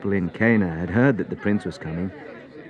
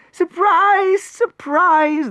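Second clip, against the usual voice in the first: very high, loud cheering; excited shout; 7 dB louder, much slower than usual. Acted. Servants running to greet the prince, shouting excitedly. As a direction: shout the good news joyfully. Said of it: "they shouted".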